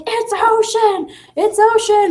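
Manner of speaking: very high, stretched-out mock squeal; imitating excited students. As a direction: act out kids bursting with the answer. Acts group shouting out the answer.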